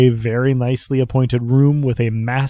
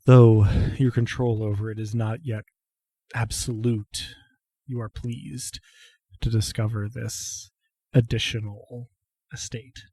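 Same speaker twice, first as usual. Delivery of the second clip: hushed scene narration; 7 dB quieter than usual. A narrator's reading. Game master to player waking in the chancel, hushed. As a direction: draw each phrase out quietly and solemnly.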